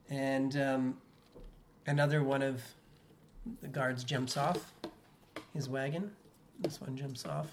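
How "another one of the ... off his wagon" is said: ordinary talk, nothing marked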